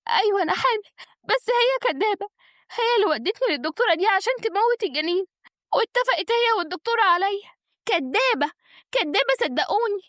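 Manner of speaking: high-pitched, pleading denial; distressed protest. Acted. Accused wife denounces the liar to family, distressed. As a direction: insist desperately on being believed.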